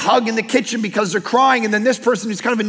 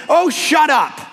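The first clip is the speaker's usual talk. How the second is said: playful; 5 semitones higher, slower than usual. Retold or imitated. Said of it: "I thought I'd be playful back".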